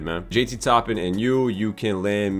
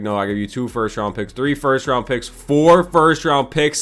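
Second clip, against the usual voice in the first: building outburst; 4 semitones higher, 5 dB louder than usual. Real, not acted. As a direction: pile on the picks louder each time. Raising offer in frustration.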